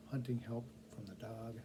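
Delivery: ordinary delivery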